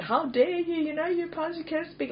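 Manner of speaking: high-pitched offended objection; mock indignation. Speaker voices critics scolding a pastor, high mock indignation. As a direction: imitate a scandalized churchgoer protesting.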